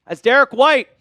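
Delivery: live play-by-play. Acted. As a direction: ride the drive like a TV announcer. Calls the game live.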